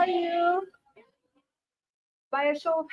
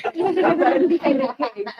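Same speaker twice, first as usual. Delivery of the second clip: loud, higher laugh at her own joke; burst of laughter; 7 semitones higher, 6 dB louder than usual. Real, not acted.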